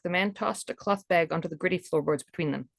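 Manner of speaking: ordinary delivery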